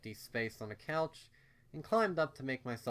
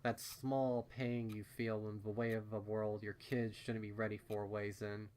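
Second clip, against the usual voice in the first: ordinary delivery, nothing marked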